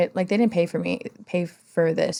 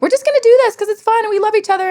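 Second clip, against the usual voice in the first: much higher and fast, airy and naive; high-pitched mockery of the naive artist; 14 semitones higher, 5 dB louder, much faster than usual. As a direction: parody a carefree collaborator who trusts everyone. Mocking naive collaborators.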